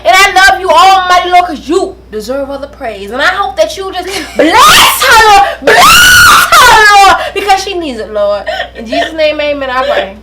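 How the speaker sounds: hammed-up preacher prayer, loud; mock prayer. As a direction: pray over her like a fake preacher. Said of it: "we gonna pray".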